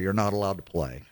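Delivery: ordinary talk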